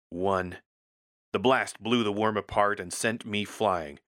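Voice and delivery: ordinary delivery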